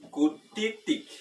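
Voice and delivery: "Kutitik" with an accented high tone. Shown as a demonstration. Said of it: "tono alto sea la primera sílaba".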